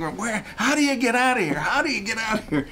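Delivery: fast, high, flustered pleading; panicked plea. Retold or imitated. Crawling past yelling women, begging for the exit in a panic. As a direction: scramble for the way out in a rush.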